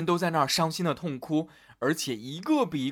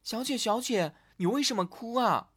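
lightly voiced maid, raised pitch; concerned maid; 6 semitones higher than usual. A narrator's reading. Maid asks the weeping Elsa why, concerned. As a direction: ask with a higher, concerned voice.

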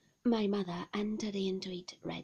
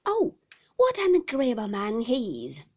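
cried out delighted; 2 semitones higher, 10 dB louder than usual. A narrator's reading. Peggotty praising her brother to the boy, crying out with delight. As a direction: burst out in delight. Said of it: "cried Peggotty".

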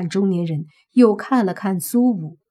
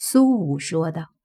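ordinary delivery, nothing marked

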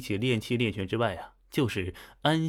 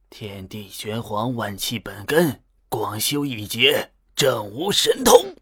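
cadenced incantation; 5 dB louder, slower than usual. A narrator's reading. Old Taoist demonstrating his spell for the master, slow cadenced incantation. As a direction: intone it with theatrical rise and fall. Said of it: "念法很有腔调，抑扬顿挫".

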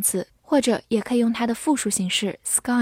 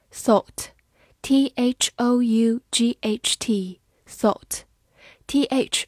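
slow spelling; much slower than usual. Shown as a demonstration. Teacher spelling a word for students, slow. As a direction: spell each letter slowly. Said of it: "spelling out the word".

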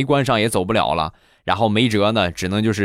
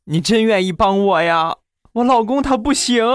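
higher, plaintive woman's voice; aggrieved neighbor; 12 semitones higher than usual. Retold or imitated. Crying neighbor complaining to the narrator about her husband, higher plaintive voice. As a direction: plead like the wronged neighbor woman. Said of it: "这个李姐很是委屈".

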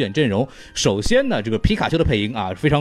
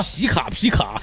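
Pikachu voice; 4 semitones higher, slower than usual. Pretend. Imitating Pikachu's cry.

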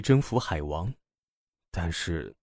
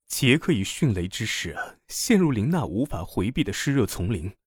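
usual speaking voice

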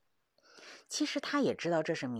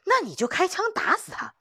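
urging shout; 13 semitones higher, 6 dB louder than usual. Acted. Old hunter urging with fist raised.

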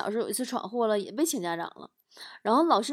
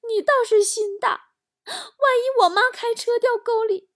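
loud, very high mock wail; hammed-up crying child; 13 semitones higher, 6 dB louder than usual. Small son fears losing mum, acted tearful wailing. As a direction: bawl it out like a protesting little boy. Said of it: "小侄子哭了".

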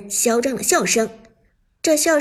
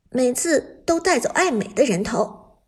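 ordinary delivery, nothing marked

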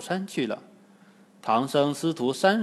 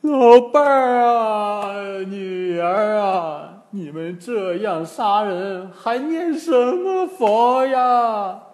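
put-on slow, higher wailing old man; drawn-out mock grief, higher and louder; wailing lament; 7 semitones higher, 7 dB louder, slower than usual. A narrator's reading. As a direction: wail over lost wife and daughter. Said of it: "拍起胸脯大哭".

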